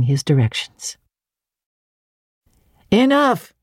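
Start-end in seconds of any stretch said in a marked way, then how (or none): 2.9-3.5 called out. A narrator's reading. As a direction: cut it off with a high call. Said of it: "he called out in a few minutes".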